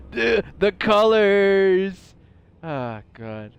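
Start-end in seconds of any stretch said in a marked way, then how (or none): 0.1-2.1 high, loud, very slow; awed. Real, not acted. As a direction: stretch it out in wonder. Amazed at the colors again.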